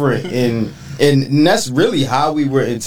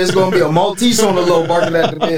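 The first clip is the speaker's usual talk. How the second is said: fast, high, laughing joke; teasing friends about a yappy Maltese, laughing; 6 semitones higher, faster than usual. Acted. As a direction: rattle it off through laughter. Joking about the dog, laughing.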